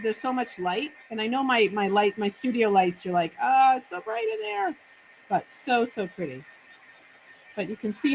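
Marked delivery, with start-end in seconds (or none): 3.4-4.8 mock viewer complaint. Viewers' remark about bright studio.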